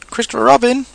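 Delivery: high, loud, hushed call; loud whisper. A narrator's reading. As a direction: call down urgently in a would-be whisper. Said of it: "in a loud whisper".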